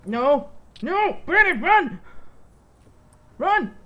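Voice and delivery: high desperate yell to run; panicked shouting. Real, not acted. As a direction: scream the warning. Teammate attacked, screaming warning.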